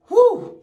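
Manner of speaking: sharp, high-pitched whew. Real, not acted. Host bracing viewers for a hot video, high loud whew.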